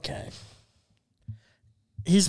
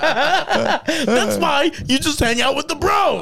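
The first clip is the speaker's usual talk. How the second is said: high, slow mock shout; hammed-up crowing rant; ranting impression; 8 semitones higher, slower than usual. Roommate lecturing on women and bros, high slow mock shout.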